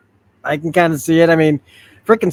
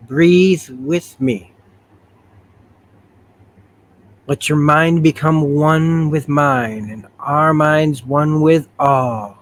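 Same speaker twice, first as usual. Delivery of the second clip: very slow, drawn-out hypnotic delivery; slow trance guiding; much slower than usual. Acted. Rachel leading Kate into a trance, slow and measured. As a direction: stretch each phrase slowly. Character guides another into a trance.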